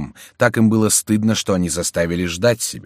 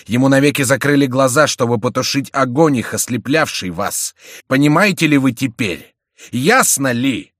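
loud, raised, declamatory indictment; impassioned accusation; 6 semitones higher, 6 dB louder than usual. A narrator's reading. Антраге accuses the миньоны of killing Бюсси, impassioned cry. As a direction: press each charge home with a raised voice. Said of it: "вскричал Антраге".

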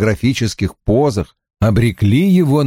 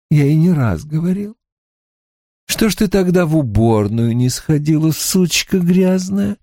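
a hint of a higher, smooth killer; calm, relishing serial killer; lightly voiced killer Creed; 6 semitones higher than usual. A narrator's reading. (слегка озвученный маньяк Крид)

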